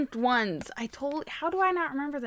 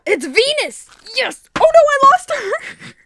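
gasp, then loud high squeals; real burst of high, loud excitement; thrilled shriek; 12 semitones higher, 12 dB louder, slower than usual. Real, not acted. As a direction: squeal it out, building to a dismayed yell. Thrilled at unboxing, then drops toy.

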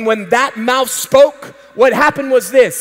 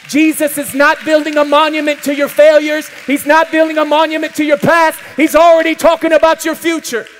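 normal talking voice

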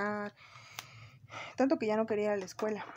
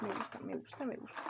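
murmured delight; 8 dB quieter than usual. Real, not acted. Murmured liking the product.

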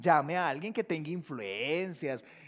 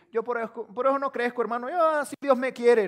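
mocking excuse; 5 semitones higher, 11 dB louder than usual. Mock excuse voice.

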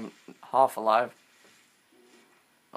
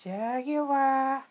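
startled; 12 semitones higher than usual. Real, not acted. Spots jaguar, exclaims.

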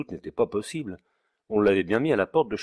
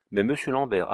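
usual speaking voice

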